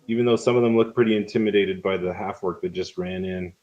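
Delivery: ordinary delivery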